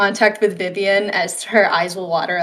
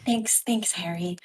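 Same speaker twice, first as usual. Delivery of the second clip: breathy near-whisper, barely there; choked-up whispered thanks; hushed thanks; 2 semitones lower, 8 dB quieter than usual. Acted. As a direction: thank him under your breath. Tearful thanks to Harry.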